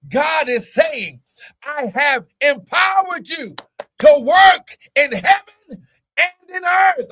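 high, punched preacher's delivery; impassioned preaching. Real, not acted. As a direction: preach it with rising force, hitting key words. Preaches at full force.

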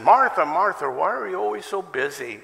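mild mock rebuke to a busy sister; gentle scolding. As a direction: chide Martha like a friend. Jesus scolding Martha.

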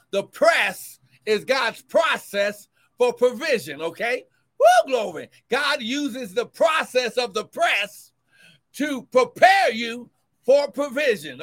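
loud, rising sermon cadence; fervent preacher building; preaching at full force. Acted. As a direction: drive the point home to the church. Preaching on the press.

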